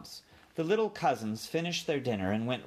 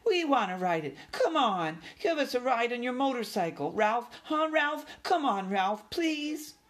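high, begging mouse squeals; pestering little mice, excited and high; squeaky little cousins; 13 semitones higher than usual. A narrator's reading. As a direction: plead in tiny high voices. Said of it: "they squeaked".

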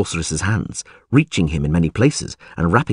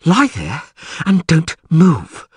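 advising, panting; 8 semitones higher, slower than usual. A narrator's reading. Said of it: "she advised, panting heavily".

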